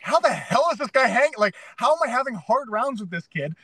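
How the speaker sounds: retelling being mad at himself; mock frustration. Fighter retelling his frustration, high exasperated rant. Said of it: "I was mad".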